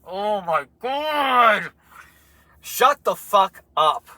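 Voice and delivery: drawn-out mock-furious rant; exasperated outburst. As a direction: stretch it out in disgust. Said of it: "I was like".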